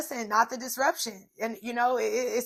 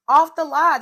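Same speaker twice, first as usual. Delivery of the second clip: Off the lot, louder again with a rise; excited; 5 semitones higher, 11 dB louder than usual. Acted. Repeating to viewers about the new car, louder. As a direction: hammer it home.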